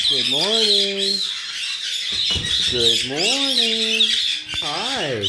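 high, slow sing-song greeting. Real, not acted. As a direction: coo good morning to the birds. Greeting the birds in cages.

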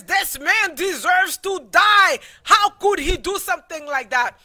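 king rages at the thief in the story; angry outburst. Acted. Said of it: "David started getting angry and says".

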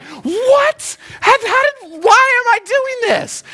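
very high, stammering incredulous wail; mock panic. Novice hears of rapids and rocks, hammed-up panic. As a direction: play up the rising panic for laughs.

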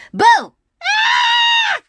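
high, loud Boo then a shriek. Acted. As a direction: scare her and squeal back. Brother scaring sister.

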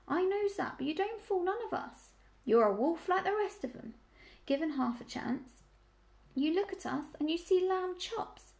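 put-on high-pitched rustic old ewe grumbling; sour. A narrator's reading. Old ewe accusing piglet of being a wolf, sourly.

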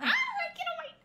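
high, loud laughing squeal; real excitement. Real, not acted. Friend dreaming aloud of a France trip, giddy excitement. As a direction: burst out with giddy, high-pitched anticipation.

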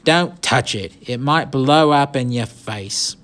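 urgent acted warning; barked warning. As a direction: warn the gang off in a rough British accent. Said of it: "the leader barked".

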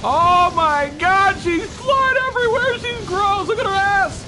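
high, loud frightened yelling; real horrified high-pitched yelling; panicked shrieking. Real, not acted. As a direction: shriek in disbelief at the monster. Monster flying around the room.

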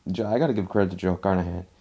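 ordinary talk, nothing marked

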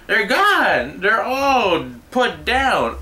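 hammed-up horrified owner; shocked workers voice. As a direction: squeal the discovery.

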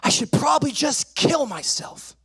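high-pitched retold outburst; distressed yell. Frustrated boy blurts to his mom, high distressed yell. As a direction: cry out like an overwrought child. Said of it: "and I just yell".

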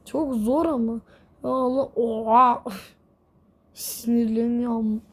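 normal talking voice

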